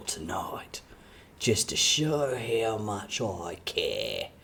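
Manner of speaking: sweet nothings; low whispered Aussie boyfriend; Australian ASMR whisper. Pretend. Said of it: "[name] doing A S M R".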